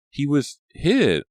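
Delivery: high, slow, frightened telling; trembling voice. A narrator's reading. Maya telling the others what happened, slow, high and shaken. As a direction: get the words out through fear. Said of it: "her voice trembling".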